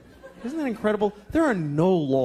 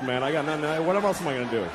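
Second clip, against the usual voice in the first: usual speaking voice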